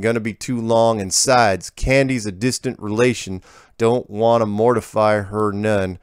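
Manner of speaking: unhurried backwoods American twang; folksy rural accent. Retold or imitated. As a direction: read the letter like an old country farmer. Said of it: "like the accent I'm throwing this".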